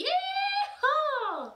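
high, loud, stretched yeehaw call. Shown as a demonstration. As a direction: call the class to shout it out. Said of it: "Can you try that?".

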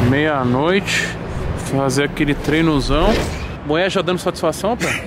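loud, pumped-up vlogger shout; hyped gym vlog. Acted. Vlogger shouting to followers, loud and pumped. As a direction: hype the camera after the pre-workout.

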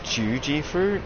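ordinary talk, nothing marked

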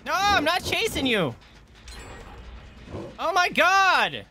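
high-pitched exasperated wail; pleading, exasperated high cry; exasperated protest. Real, not acted. Protesting as players keep fleeing.

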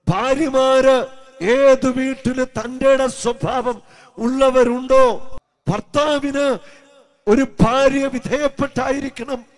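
loud, high, slow pulpit cry; a slow, loud sermon call; preaching at full force. Acted. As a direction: drive each duty home to the congregation. Preaching duties of wives.

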